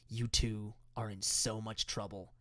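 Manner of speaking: soft, low, slow warning; imitated whispering threat. Dad warning his two kids on the way out, whispering. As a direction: keep the threat hushed and drawn out. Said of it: "whispering stuff like".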